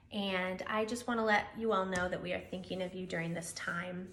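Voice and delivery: ordinary delivery, nothing marked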